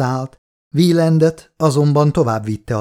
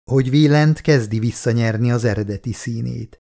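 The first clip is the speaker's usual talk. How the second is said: plain reading voice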